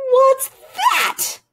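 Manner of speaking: high, loud excited duck cry; excited shout. A narrator's reading. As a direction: shout it out as he spots something far off. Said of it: "he quacked".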